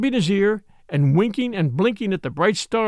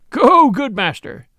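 a louder, slower hearty roar; friar's roar; 5 semitones higher, 6 dB louder, slower than usual. Acted. Friar Tuck roars at Robin to go, louder and slower. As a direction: boom it out like a jolly friar. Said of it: "roared Friar Tuck".

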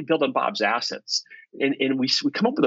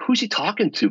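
puzzled; 8 semitones higher than usual. Retold or imitated. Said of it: "I'm like".

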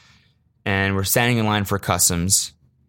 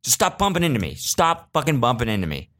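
irritated snap; 7 semitones higher than usual. Retold or imitated. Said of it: "and you're like".

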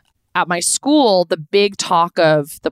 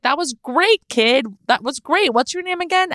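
higher, lightly voiced friendly actor; a hint of a kind celebrity, amused; Matt LeBlanc; 8 semitones higher than usual. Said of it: "he started laughing and was like".

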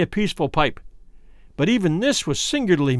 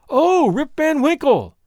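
much higher, louder, slow drawn-out recognition; surprised exclamation; 9 semitones higher, 3 dB louder, slower than usual. A narrator's reading. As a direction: exclaim as if suddenly remembering. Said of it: "exclaimed two or three".

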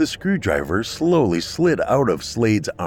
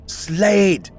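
high, loud call of a name; shouting; 11 semitones higher, 4 dB louder than usual. A narrator's reading. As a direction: shout his name sharply. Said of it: "Isla shouted through Kat".

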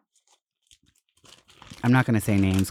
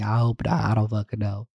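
ordinary delivery

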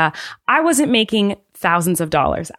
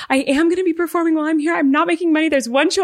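much higher, fast jittery explanation; nervous confession; 10 semitones higher, faster than usual. Retold or imitated. Performer explaining to the officer, high and fast with nerves. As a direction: blurt it all out in a nervous rush.

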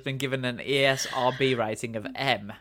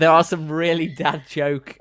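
laughing; 4 semitones higher, 6 dB louder than usual. Real, not acted. Giggles describing sex lines.

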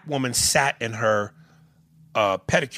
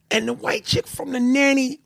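nasal Nanny voice; 13 semitones higher than usual. Retold or imitated. Imitating the Nanny's whiny voice.

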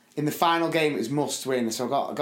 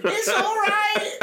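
drawn-out, squeaky mock cheer; hammed-up high, louder comforting shout; pained reassurance; 5 dB louder, much slower than usual. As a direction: squeal it slowly as if hiding pure pain. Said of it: "I looked in pure pain going".

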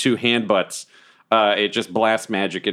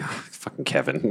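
annoyed whisper; 6 dB quieter than usual. Acted. Cleric healing Kevin, weary whispered grumble. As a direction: grumble under your breath. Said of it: "Where I'm just like".

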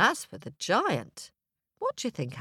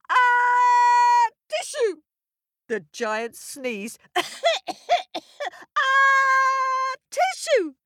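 huge high sneeze; giant sneeze; 7 dB louder than usual. Acted.

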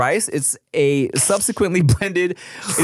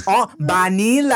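put-on Thai accent; 6 semitones higher than usual. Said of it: "they were like".

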